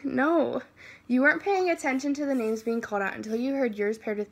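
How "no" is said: ordinary delivery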